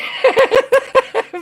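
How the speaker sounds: high, loud burst of giggles; real giggle. Real, not acted. Host unsure of saying a name right, high giggle. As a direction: giggle helplessly at your own fumble. Laughing at own name trouble.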